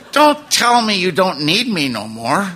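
high, drawn-out wail; hammed-up heartbroken protest; wounded plea. Retold plea to the departing wife.

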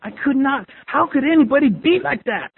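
high, insistent disbelief, retold; incredulous disgust. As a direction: relive an old appalled vow. Recalled inner vow, incredulous.

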